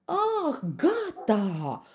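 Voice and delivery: high, loud wail of pain; howling. A narrator's reading. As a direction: howl for it to stop. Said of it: "urlă leul". (urlet de durere)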